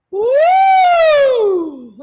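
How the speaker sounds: loud, very high siren swoop; big swooping warm-up whoop. Shown as a demonstration. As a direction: push the siren up high and slide down loudly. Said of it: "And a little bit higher".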